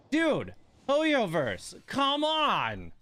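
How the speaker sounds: high, drawn-out pleading whine; stretched-out frustrated plea to the game; exasperated plea. Real, not acted. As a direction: beg the game, exasperated and slow. Frustrated at bad gacha luck.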